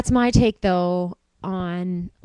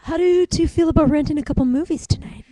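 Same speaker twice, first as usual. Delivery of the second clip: put-on higher, fast Stittsville accent; brisk chipper Ottawa-suburb accent; quick perky Stittsville accent; 5 semitones higher, faster than usual. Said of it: "The Stittsville accent".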